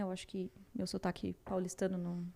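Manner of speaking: ordinary talk, nothing marked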